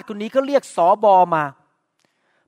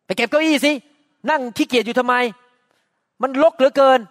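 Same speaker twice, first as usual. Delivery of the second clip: sharp, louder nagging command to the spouse; bossy scolding order; 4 semitones higher, 3 dB louder than usual. Retold or imitated. Scolding a spouse for lazing about, higher and louder. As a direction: bark the chores at a lazy partner. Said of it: "บอกว่า".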